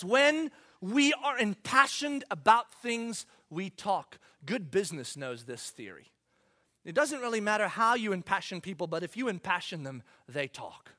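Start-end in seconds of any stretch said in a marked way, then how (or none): none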